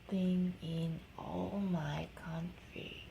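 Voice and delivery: hushed, slow whisper. Acted. Mother reading the king's book to her baby, a slow whisper. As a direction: breathe it like a secret.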